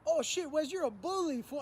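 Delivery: high, loud voice of a laughing friend; teasing homie. Retold or imitated. Friend at the cell window teasing Wes as a bully. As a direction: rib him through the window.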